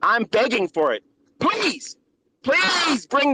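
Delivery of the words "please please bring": taunting plea. Real, not acted. Taunting rival on a stream.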